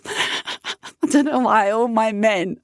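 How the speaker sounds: normal talking voice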